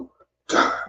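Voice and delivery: normal talking voice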